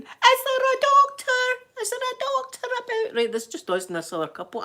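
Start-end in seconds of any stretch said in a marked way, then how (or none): 0.0-3.2 mock scream. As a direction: call up the mountain in fake panic. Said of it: "I'm screaming up the mountain".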